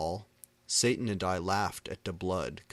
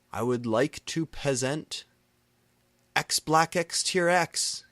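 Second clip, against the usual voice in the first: stage announcement; 8 semitones higher, 6 dB louder than usual. A narrator's reading. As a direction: introduce the band grandly. Singer announcing a band.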